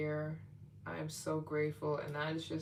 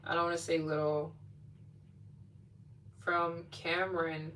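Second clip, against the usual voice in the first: normal talking voice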